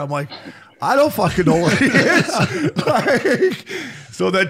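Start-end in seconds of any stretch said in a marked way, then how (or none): 0.8-3.7 laughing quote. Retold or imitated. Retold reply to missed calls.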